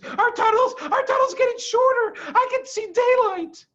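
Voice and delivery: put-on very high, loud child's voice; urgent, like a scared cub; alarmed child. A narrator's reading. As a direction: fox cub warning the family. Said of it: "said one of the small foxes".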